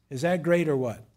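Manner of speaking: ordinary talk, nothing marked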